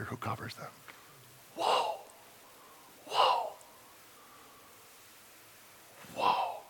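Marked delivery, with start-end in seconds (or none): 1.5-6.6 awed whoa. Real, not acted. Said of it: "awed pause in sermon".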